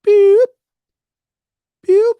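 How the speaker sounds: high squeaky beep noises; two short piped beeps; mock beeps. Imitating a buzzer sound.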